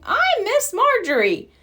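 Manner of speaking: high, lightly voiced kindly old woman; old lady hello. A narrator's reading. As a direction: greet the pups sweetly. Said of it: "said an older woman".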